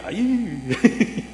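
high little "ay" of delight; retold private thrill; secretly excited. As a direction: let out a small, high squeal of glee. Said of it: "por dentro así un poquito emocionadillo".

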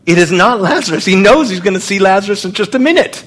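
high, urgent, rushing insistence; emphatic insistence. Real, not acted. Preacher repeating his point to the congregation, urgently insistent.

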